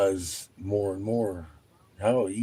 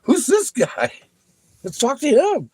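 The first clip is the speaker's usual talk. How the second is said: high, loud, eager bystander voice; intrigued onlooker; 7 dB louder than usual. Retold or imitated. Newcomer voiced by the storyteller as a nosy, excited crowd member. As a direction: pitch way up, all curious interest.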